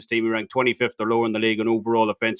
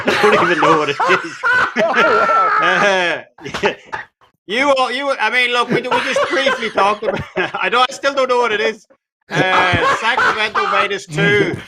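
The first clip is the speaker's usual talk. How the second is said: higher, louder, giggling while trying to talk; can't stop laughing at the joke; laughing through words; 10 semitones higher, 4 dB louder than usual. Real, not acted. Laughing at the Swiss Miss joke while giving his pick.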